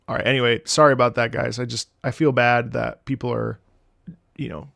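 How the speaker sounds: ordinary delivery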